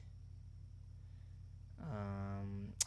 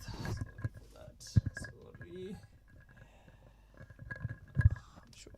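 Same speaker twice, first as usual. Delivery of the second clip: soft hushed apology; whispering; 8 semitones higher, 5 dB quieter, much slower than usual. Real, not acted. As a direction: whisper it under your breath. Apologizing during live tutorial.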